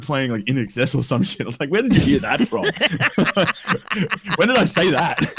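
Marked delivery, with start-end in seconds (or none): 1.7-5.3 high, laughing mock scold; laughing mock outrage. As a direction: tell off the speaker through laughter. Mock-scolding his Google, laughing.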